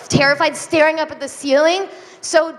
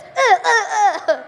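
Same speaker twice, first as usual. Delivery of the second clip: mock stammering ex; 6 semitones higher, much slower than usual. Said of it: "he was like this".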